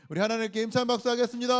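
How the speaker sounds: high, loud, quick call for applause; rally the room to clap; rousing call. Real, not acted.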